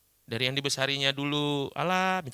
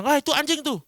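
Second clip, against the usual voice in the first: high, louder name-calling; raised-pitch taunt, acted out; mock jeer; 12 semitones higher, 6 dB louder than usual. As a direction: imitate someone jeering and pointing out a target. Acted jeer from another person.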